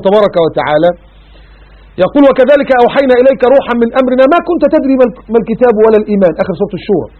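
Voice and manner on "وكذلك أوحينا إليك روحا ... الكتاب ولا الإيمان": Quran recitation. Acted. Recites verse.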